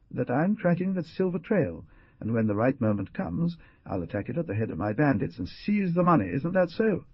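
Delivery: forceful finish of another's sentence; energetic interruption. A narrator's reading. Jaguar interrupting the hunter about the silver trail, energetic. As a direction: cut in energetically, lifting the pitch. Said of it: "interrupting him energetically".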